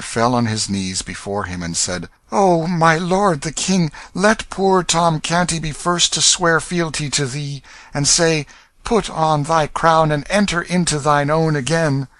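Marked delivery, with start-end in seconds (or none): 2.3-12.1 high, louder eager homage; glad pleading. A narrator's reading. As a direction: kneel and greet him with joy. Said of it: "with a glad face".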